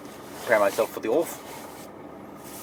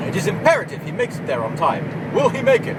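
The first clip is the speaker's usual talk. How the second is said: higher, louder dramatic voice-over; dramatic TV narration about himself; mock documentary narrator; 10 semitones higher, 4 dB louder than usual. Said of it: "narrator of a TV documentary".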